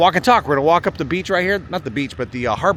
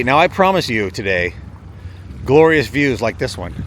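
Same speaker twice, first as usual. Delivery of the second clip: ordinary delivery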